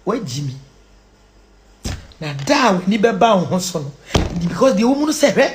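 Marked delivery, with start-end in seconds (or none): 0.1-0.5 low, softer echo; quiet, deep aside; subdued repeat. Real, not acted. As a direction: drop the pitch right down. Hushed repeat of the statement.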